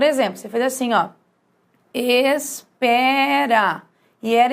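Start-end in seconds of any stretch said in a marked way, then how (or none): 1.9-3.8 "espera" drawn out syllable by syllable; slow dictation. Shown as a demonstration. Teacher writes an example for students, slow dictation. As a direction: say "espera" very slowly, as if writing it. Writing an example word out slowly.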